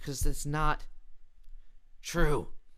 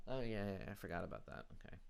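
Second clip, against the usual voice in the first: ordinary delivery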